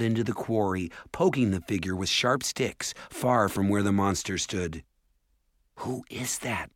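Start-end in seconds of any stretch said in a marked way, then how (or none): 5.8-6.7 soft hushed question; whispering. A narrator's reading. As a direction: whisper it, hiding. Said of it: "Mallory whispered".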